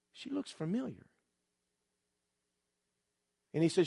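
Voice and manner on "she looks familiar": very soft, higher, wondering thought; hushed recognition. Retold or imitated. Man spotting a girl from his past, hushed recognition. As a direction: think it aloud, barely voiced.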